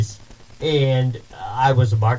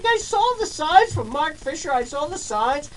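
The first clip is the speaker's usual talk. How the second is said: much higher, eager and rushed; she's thrilled to spot the lawn signs; excited recognition. Retold or imitated. Kate telling the homeowner she saw his signs, much higher and excited. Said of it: "And she's like".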